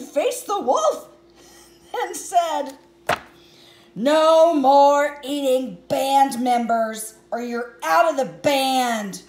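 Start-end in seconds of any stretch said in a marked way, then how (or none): 3.9-9.2 loud, slow, firm telling-off; stern scolding. Acted. Poco scolds the wolf who ate the rabbit, loud and stern. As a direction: lay down the law to the wolf.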